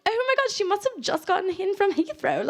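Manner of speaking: put-on high, gushing British accent; impressed onlooker in a bad British accent; mock British accent. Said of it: "have a great British accent".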